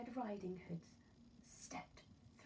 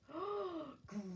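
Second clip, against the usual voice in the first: fearful gasp; 8 semitones higher than usual. Acted. Girl frightened by the grin.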